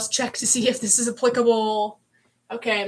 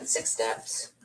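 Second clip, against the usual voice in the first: hushed whisper of the words; 14 semitones lower, 8 dB quieter than usual. Real, not acted. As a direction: breathe it out in a whisper. Saying the final step count.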